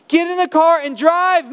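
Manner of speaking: "Get in the car and drive" yelled high and loud; angry scolding. Pretend. Helper yelling at the man pushing his car, angry. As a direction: bark "Get in the car and drive" at him in exasperation.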